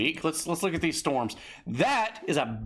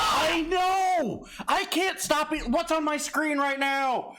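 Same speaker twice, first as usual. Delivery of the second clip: mock panic; 12 semitones higher, slower than usual. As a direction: yell like you're losing control. Mock panic over the Elden Ring music.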